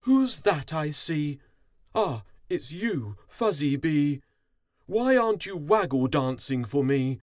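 high, loud, cross royal call; cross queen. A narrator's reading. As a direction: scold a subject as an indignant queen. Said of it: "the queen called crossly".

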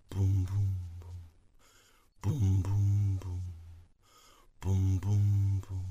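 low rolling booms; distant thunder booms. Acted. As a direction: imitate thunder in low, even pulses. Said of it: "the thunder rolled and boomed".

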